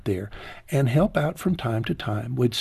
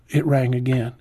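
ordinary talk, nothing marked

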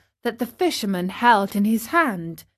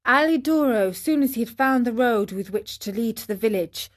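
plain reading voice

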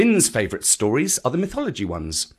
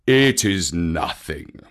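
low, slow bear voice; Baloo; 6 semitones lower, slower than usual. Acted. Baloo says it is nothing, low and slow. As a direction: draw it out slowly and low. Baloo brushes off his hurts.

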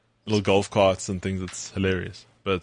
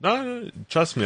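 high, slow insistence; drawn-out pleading no, no, trust me; mock protest; 12 semitones higher than usual. Real, not acted. Insisting to cohost he has time, high and drawn out. Teased about trip, protests.

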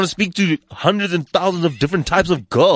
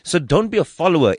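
ordinary talk

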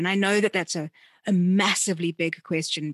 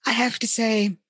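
ordinary talk

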